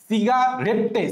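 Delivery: "সিগারেটে" split as siga, ret-te; spelling pronunciation. Shown as a demonstration. Teacher repeats for spelling, "সিগারেটে" ending in ret-te. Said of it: "এখন উচ্চারণ করবো হচ্ছে সিগারেটে".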